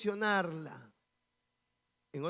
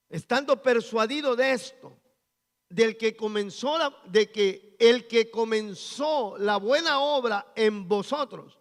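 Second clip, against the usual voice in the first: loud proclaimed verse, slowing on the key words; preached scripture reading; 3 semitones higher, 7 dB louder than usual. Acted. Pastor proclaiming a Philippians verse to the church, loud and fervent. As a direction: read the verse like a sermon climax.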